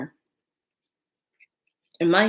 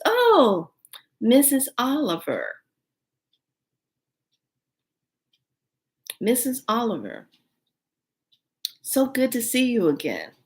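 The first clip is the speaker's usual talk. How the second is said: lightly voiced waitress, higher and gushing; 6 semitones higher than usual. Head waitress welcoming a regular, lightly voiced higher gushing. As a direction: greet her like a beaming hostess. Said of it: "head waitress lady comes up and says".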